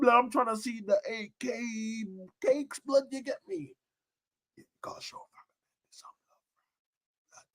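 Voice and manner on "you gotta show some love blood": soft unvoiced whisper; hushed live-stream whisper. Real, not acted. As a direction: breathe it like a secret.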